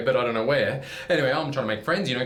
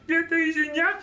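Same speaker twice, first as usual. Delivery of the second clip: Martian voice; 13 semitones higher than usual. Retold or imitated. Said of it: "Marvin the Martian hands me".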